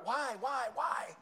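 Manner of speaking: high-pitched, insistent imitation of a child; vehement pestering child. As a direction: pester like a kid told no. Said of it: "they're just vehement about it".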